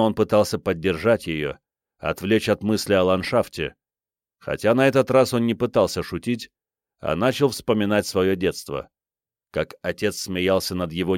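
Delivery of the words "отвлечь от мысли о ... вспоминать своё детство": usual speaking voice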